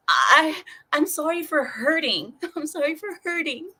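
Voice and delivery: high-pitched whimpering apology; mock tearful apology. Client apologizing to cursing Russian masseuse, mock tearful. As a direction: plead pitifully, breaking into laughter. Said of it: "I start crying".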